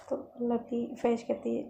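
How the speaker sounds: ordinary delivery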